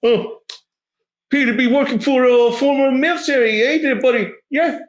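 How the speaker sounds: high-pitched, hesitant shakedown voice; shady guard. Acted. Guard stopping travelers at the gate, high put-on voice.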